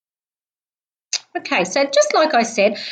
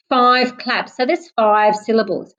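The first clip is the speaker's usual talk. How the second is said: ordinary talk, nothing marked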